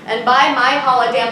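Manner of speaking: ordinary talk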